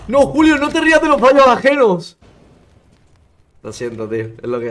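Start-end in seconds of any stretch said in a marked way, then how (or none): 0.1-2.2 higher, louder mock-stern telling-off; mock scolding. Scolding himself for laughing at Julio, mock-stern. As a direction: scold himself in jest, loud and high.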